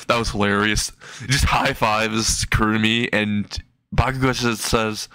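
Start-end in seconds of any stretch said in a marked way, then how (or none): none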